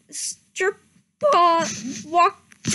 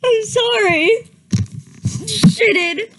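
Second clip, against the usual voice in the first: laughing apologies; 8 semitones higher than usual. Real, not acted. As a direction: still can't stop laughing. Keeps apologizing between laughs.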